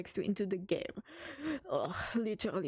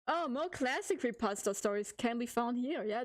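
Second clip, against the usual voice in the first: ordinary talk, nothing marked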